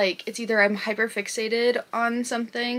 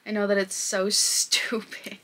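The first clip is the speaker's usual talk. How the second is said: laughing whisper. Real, not acted. Embarrassed laugh at herself.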